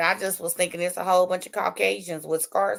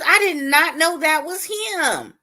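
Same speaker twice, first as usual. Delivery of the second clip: shocked disbelief; 9 semitones higher, 4 dB louder, slower than usual. Real, not acted. Repeated disbelief.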